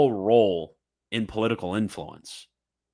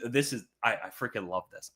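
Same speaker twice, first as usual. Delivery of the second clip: usual speaking voice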